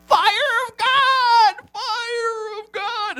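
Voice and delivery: high, loud ecstatic cry; yelled call. Retold or imitated. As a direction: shout it out like a fired-up worshipper. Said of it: "some young man starts yelling out".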